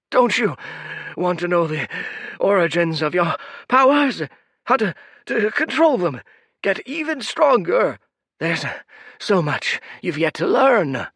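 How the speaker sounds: put-on high, loud pleading old man; desperate old priest. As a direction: beg her to stay and listen. Priest pleads after the girl.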